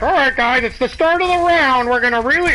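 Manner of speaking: high-pitched imitated rallying call; mock pep talk. Acts out start-of-round pep talk.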